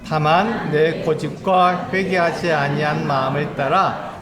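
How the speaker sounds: slow, loud unison Bible reading; whole church reading Romans aloud together; scripture reading. Acted. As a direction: read it out together, measured and solemn. Congregation reads Romans aloud.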